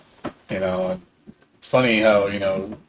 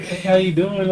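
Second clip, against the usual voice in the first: high-pitched, welcoming hello; friendly greeting; 9 semitones higher than usual. Retold or imitated. Imitating family restaurant owners to a new customer, friendly. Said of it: "so friendly".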